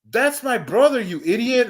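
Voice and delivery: exasperated girlfriend imitation. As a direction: snap it at him like she's fed up. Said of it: "It's all like".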